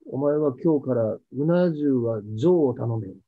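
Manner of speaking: slow, low-pitched fatherly praise, lightly played; lightly voiced stubborn old man. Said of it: "とかって言うんですよね".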